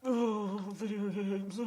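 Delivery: high-pitched put-on politician voice; Bill Clinton. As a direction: do a dubious impression, pitched well up. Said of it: "Is that your Bill Clinton?".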